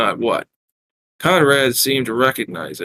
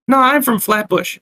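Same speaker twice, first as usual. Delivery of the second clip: high-pitched Brooklyn barmaid; heavy Brooklyn accent; 12 semitones higher, 3 dB louder than usual. Acted. Barmaid taking the newcomers' order, heavy Brooklyn accent. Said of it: "asked in a heavy Brooklyn accent".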